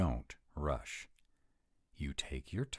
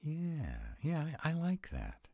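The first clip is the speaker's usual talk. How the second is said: delighted; 11 semitones higher than usual. Acted. Delighted by decorating.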